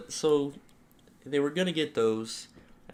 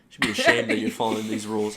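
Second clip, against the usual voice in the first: louder, fast retold scolding; mock scolding; 7 dB louder, faster than usual. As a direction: rattle it off like an outraged customer. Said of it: "He's like".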